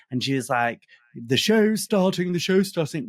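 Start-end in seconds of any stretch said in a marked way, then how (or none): 1.1-3.1 posh lady. Retold or imitated. Posh hostess calling guests to the show, plummy and high. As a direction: ring out the call like a grand hostess. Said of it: "She was the poshest woman ever".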